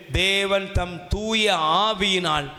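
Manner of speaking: slow, intoned verse with stretched vowels; half-chanted Bible verse; solemn scripture reading. Real, not acted.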